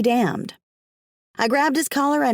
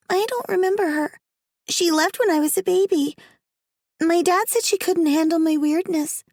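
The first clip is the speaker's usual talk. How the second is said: put-on high child voice, a bit weary; little girl Alice; 10 semitones higher than usual. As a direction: speak as a young girl telling her story.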